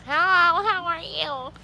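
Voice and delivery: put-on high, childish greeting, softening at the end; kid voice. Shown as a demonstration. Showing how she thinks she sounds, high kid voice. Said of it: "I literally think I sound like this".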